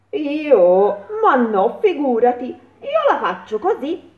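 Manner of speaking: a pigeon shrugging it off. A narrator's reading.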